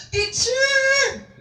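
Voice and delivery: high, loud recognition cry; joyful cry. Acted. Mary recognizing Jesus, high loud joyful cry. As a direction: cry out in sudden joy.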